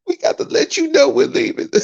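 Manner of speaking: squeaky falsetto crybaby imitation; hammed-up sobbing protest, sky-high; mock crying. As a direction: whine it very high, making fun of the complainers. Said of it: "cry in that goddamn corner".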